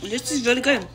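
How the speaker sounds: mouth full of hot food. Real, not acted. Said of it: "It burned my tongue".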